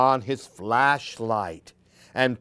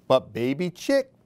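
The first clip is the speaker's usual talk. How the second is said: usual speaking voice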